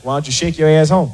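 low, cold brush-off; cold dismissal. Retold or imitated. Boyfriend brushing off his girlfriend, cold low voice. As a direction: shut her down flatly. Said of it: "the man get real cold on you".

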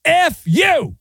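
sharp high mock yell of F you. Ref to tight end, mock angry yell.

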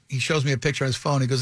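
ordinary talk

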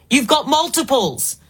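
ordinary talk

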